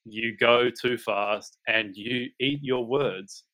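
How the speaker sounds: drawn out word by word to mock the complaint; ironic slow read. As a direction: read it very slowly, tongue in cheek. Reading a complaint he speaks too fast.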